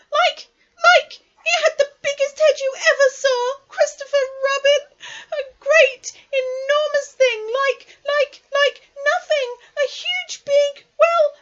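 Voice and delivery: very high, loud, stammering squeal; frightened Piglet. A narrator's reading. Piglet describing the Heffalump to Christopher Robin, panicky squealing. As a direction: squeak it out frantically, groping for words.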